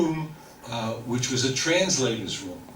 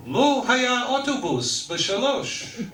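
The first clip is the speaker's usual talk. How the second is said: indignant complaint; 12 semitones higher, slower than usual. Retold or imitated. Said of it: "complaining to the clerk".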